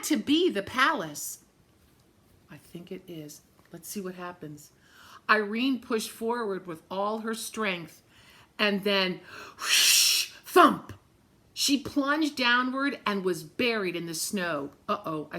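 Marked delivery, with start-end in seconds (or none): 2.5-4.7 whisper-close, building suspense; hushed aside. Real, not acted. 9.6-10.9 breathy whoosh then loud thump; storyteller sound effects for a slide and crash. A narrator's reading.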